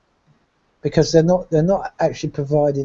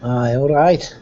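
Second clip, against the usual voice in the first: put-on Nottingham accent; 3 dB louder than usual. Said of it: "I can't do a Nottingham accent".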